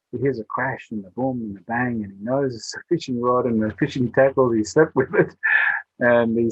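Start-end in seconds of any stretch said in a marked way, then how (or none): none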